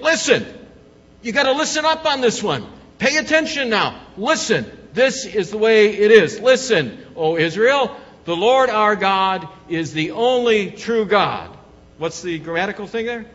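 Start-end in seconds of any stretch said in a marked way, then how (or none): 0.0-11.5 loud, high-pitched commanding drill sergeant; emphatic basic-training instructor at full projection. Acted. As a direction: order recruits to pay attention, every phrase punched. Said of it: "when the drill sergeant says something".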